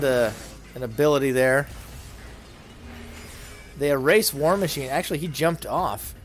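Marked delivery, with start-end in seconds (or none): none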